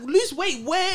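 high, loud, scoffing question; indignant retort. Retold or imitated. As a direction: toss it back in disbelief. Quoting outraged retort.

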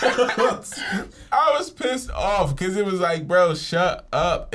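high, slow words through laughter; laughing. Real, not acted. Telling friends about the school stage, laughing hard. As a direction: laugh while forcing it out. Laughing retelling his anger.

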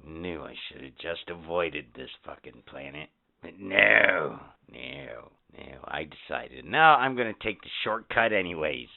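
low, soft grumbling alien. Acted. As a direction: grumble like a stranded space alien. Plays stranded alien.

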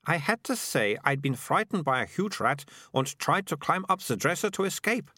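put-on high-pitched girl, justifying herself; Melicia explaining. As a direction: tell your tale in a high girlish voice. Narrator plays Melicia explaining.

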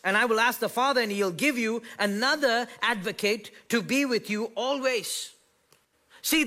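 high, loud preacher's call; fired-up sermon delivery; full-force preaching. Acted. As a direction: preach it out to the room, rising.

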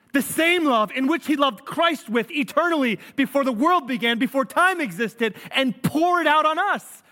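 high, emphatic preacher at full force; impassioned preaching. Real, not acted. Pastor proclaiming the gospel to his congregation, impassioned preaching. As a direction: drive each phrase home with rising fervour.

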